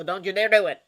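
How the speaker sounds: loud, forceful warning; stern warning. Acted. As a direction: snap the warning out hard. Doctor warns Harry sternly.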